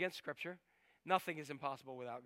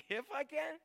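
incredulous Jesus; 9 semitones higher than usual. Retold or imitated. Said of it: "Jesus looked at him and he said".

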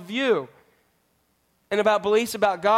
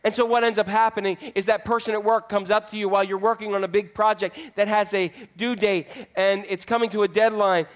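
normal talking voice

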